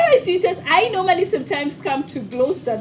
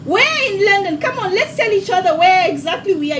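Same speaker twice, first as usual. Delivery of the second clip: ordinary talk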